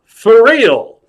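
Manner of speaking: loud, high rise then drop; emphatic confirmation. Acted. Clown insists to star he does magic, loud emphatic answer. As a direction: answer firmly with a big falling swoop.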